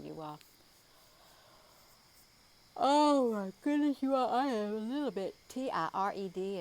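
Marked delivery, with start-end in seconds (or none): 2.8-6.6 words stretched through a big real yawn; sleepy yawn breaking into the words. Real, not acted. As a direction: yawn your way through it, tired. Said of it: "I apologize for yawning".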